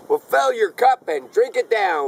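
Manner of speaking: high, hearty call round the fire; yelled toast. Acted. Trapper to the men round the fire, mild yell. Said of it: "was yelled round the fire".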